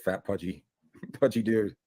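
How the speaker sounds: usual speaking voice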